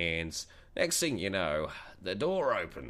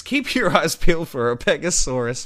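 announcer; 9 semitones higher, 9 dB louder than usual. Acted. Hammy outro announcement.

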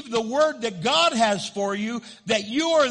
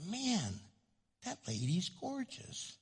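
hushed aside; 12 dB quieter than usual. Retold or imitated. A man murmuring to a friend about a woman, hushed. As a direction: say it under your breath to a friend.

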